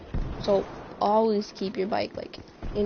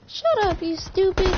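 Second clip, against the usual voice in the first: put-on high, heated child's shout; angry kid yelling; 9 semitones higher than usual. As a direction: snap at the thief like a furious little kid, much higher. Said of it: "I was like".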